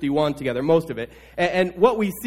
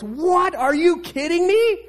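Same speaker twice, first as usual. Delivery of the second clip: much higher and louder outraged cry; raised voice; 13 semitones higher, 4 dB louder than usual. Acted. King David shouting at Nathan's story, high and outraged. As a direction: burst out in disbelief. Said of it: "raising his voice".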